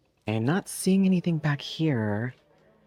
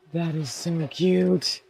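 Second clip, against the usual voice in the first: cooing; 6 semitones higher, 4 dB louder, slower than usual. Real, not acted. Cooing over a find.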